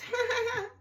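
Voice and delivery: sudden high squeal of a laugh; high burst of laughter. Real, not acted. Laughing at Lauren giggling, a high squealing laugh.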